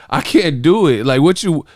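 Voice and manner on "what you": usual speaking voice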